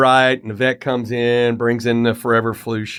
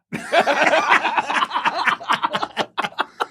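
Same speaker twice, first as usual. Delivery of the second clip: high laugh. Real, not acted. Laughs at own punchline.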